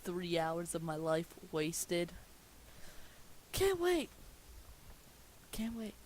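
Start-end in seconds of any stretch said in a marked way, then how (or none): 3.5-4.1 high, loud sarcastic cheer; mock excitement. Reader faking glee about a three-hour reading, mock cheer. 5.5-5.9 whispered flat aside; deadpan whisper. Acted. Reader muttering the truth to listeners, whispered.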